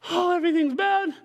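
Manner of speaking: high mock wail of complaint; whining complaint. Preacher voicing Israelites who complain in cycles, whining. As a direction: imitate a whiner, pitched well up. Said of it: "they start whining and complaining".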